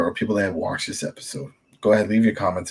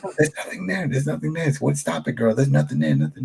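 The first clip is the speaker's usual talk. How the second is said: higher, brushing her off; mother dismissing daughter; 6 semitones higher than usual. Retold or imitated. Host imitates Alpha shushing her daughter, mock higher voice. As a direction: shush the girl, nothing's there. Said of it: "she's like".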